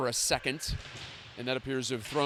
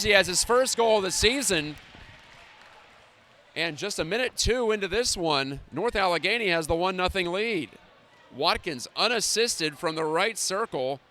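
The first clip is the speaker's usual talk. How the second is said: hockey play-by-play; 5 semitones higher, 4 dB louder than usual. Acted.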